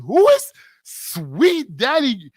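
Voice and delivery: mock bewildered churchgoer; retold disbelief. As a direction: drag the question out in disbelief. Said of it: "But I said".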